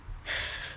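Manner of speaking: soft, voiceless laugh; real quiet laughter to yourself; amused soft laughing. Real, not acted. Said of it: "I'm sorry, I'm laughing".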